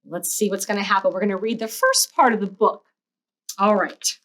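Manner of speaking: ordinary delivery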